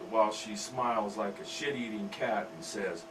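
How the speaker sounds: ordinary delivery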